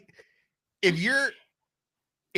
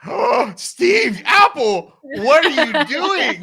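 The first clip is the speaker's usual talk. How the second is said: high, loud, slow mock-wailing "what are you doing"; mock dismay; 11 semitones higher, 10 dB louder, much slower than usual. Host mock-laments to Apple, high and loud, laughing. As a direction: wail at Apple in fake despair, laughing. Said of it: "I was like, gasps".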